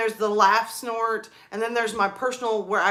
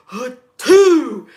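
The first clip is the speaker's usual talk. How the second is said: huge, loud reenacted sneeze; allergy sneeze; 2 semitones higher, 12 dB louder than usual. Working alone with bad allergies, reenacted booming sneeze. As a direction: blast out an explosive mock sneeze. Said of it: "allergies was killing me".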